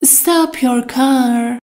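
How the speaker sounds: slow, each word laid out clearly; slow model sentence. Shown as a demonstration. Teacher to Persian learners, slow and clear. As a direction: spell it out slowly for learners. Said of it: "teacher repeats the model sentence".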